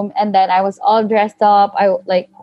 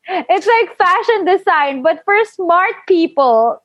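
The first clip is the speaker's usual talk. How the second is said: higher-pitched, mock-defensive explanation; retold self-defense, pitched up; 7 semitones higher than usual. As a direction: justify yourself, stretching the final phrase. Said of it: "just to defend myself".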